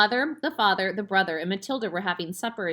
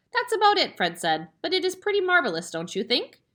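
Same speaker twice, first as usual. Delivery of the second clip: put-on high, quick proud boy's voice; little boy; 10 semitones higher, 4 dB louder than usual. A narrator's reading. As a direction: show off his parrot, high. Little boy Fred proud of parrot.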